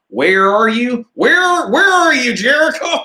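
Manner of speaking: very high, hammed-up American accent with hard Rs; mock American English. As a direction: squeal it like a caricature of an American voice. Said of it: "when they hear that American English".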